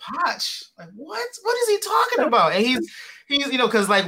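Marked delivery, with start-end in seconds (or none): 0.0-2.5 high-pitched mock bafflement; baffled. Students puzzling over the coach's accent, comically baffled. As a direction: squeak it out in puzzled disbelief.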